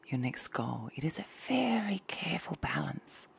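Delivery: hushed dreamy ASMR voice; ASMR whisper. Acted. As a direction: breathe the words close to the mic like ASMR.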